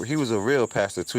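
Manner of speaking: ordinary delivery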